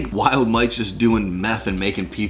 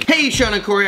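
usual speaking voice

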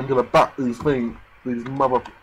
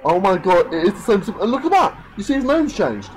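louder, excited disbelief, pointing it out; amazed; 5 semitones higher, 3 dB louder than usual. Real, not acted. Player showing viewers the sim's changed name, amazed. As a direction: pitch climbing high in astonishment.

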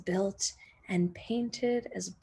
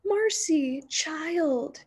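put-on higher, tender voice of an old man; touched old man; 8 semitones higher, much slower than usual. Old Man Hammer to Marcy, a lightly voiced softened old man. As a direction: let his heart soften on her name.

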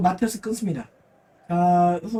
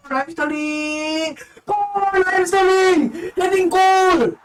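esports caster; 9 semitones higher, slower than usual. Acted. Goal call in live commentary.

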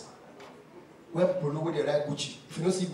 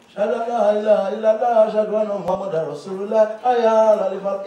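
hammed-up call to prayer, louder; loud mock call to prayer; 5 semitones higher, 5 dB louder than usual. Comic mimicking a mosque call, loud mock adhan. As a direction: chant it like a muezzin, raised and quick.

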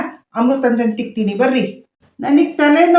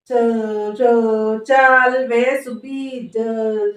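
stammering son straining to call his wife; stuttering son; much slower than usual. Acted. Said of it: "ನೀನ್ ನೋಡಿದ್ರೆ ತೊದ್ಲ".

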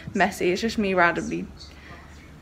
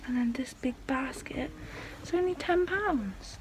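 whispering; 11 dB quieter than usual. Real, not acted. Whispering inside a quiet shop.

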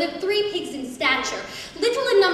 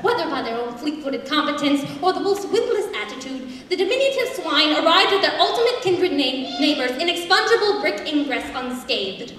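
usual speaking voice

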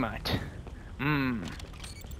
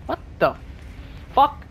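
shocked yelp; 11 semitones higher than usual. Real, not acted. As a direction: blurt it out in shock. Shocked by sudden location change.

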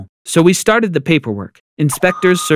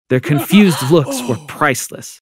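plain reading voice

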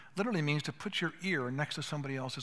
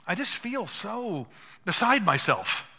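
slow, higher, louder and distressed; upset wife; 5 semitones higher, 6 dB louder, slower than usual. Retold or imitated. Pastor voicing his wife Rachel's distress, slow and pained. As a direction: let the overwhelm come through slowly. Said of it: "when Rachel says to me".

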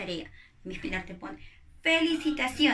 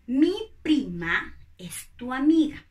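affirmative example; 2 semitones higher, 7 dB louder than usual. Shown as a demonstration.

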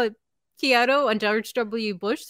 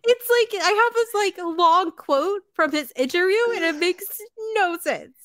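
exasperated amusement; 12 semitones higher than usual. Real, not acted. Reacting to the absurd quote.

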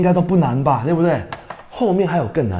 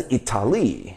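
"Italy" stressed wrongly as i-ta-LEE; stress on last syllable; 5 semitones lower, 7 dB quieter than usual. Shown as a demonstration. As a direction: land hard on the end of "Italy". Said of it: "重音在哪里".

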